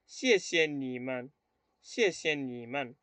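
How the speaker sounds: "Xie xie ni man" slowly, evenly spaced; model pronunciation. Shown as a demonstration. Teacher showing plural thanks, slow model pronunciation. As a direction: draw out "Xie xie ni man" for learners. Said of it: "quiero decir gracias a ustedes".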